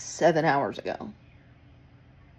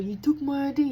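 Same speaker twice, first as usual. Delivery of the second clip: higher, agitated complaint in another voice; mock distressed man, pitched up; upset New York dude; 8 semitones higher than usual. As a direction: play the shaken guest accusing. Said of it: "New York dude, going".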